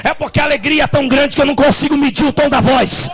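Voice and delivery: loud, high joyful preaching shout. Real, not acted. Pastor apologizing to the church for shouting, jubilant shout. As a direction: shout it out, overflowing with joy. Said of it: "me desculpa se eu tô gritando muito".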